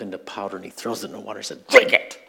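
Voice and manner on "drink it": loud, harsh shouted order; angry command. Retold or imitated. Moses ordering the people to drink the idol, furious shout. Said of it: "He's so mad".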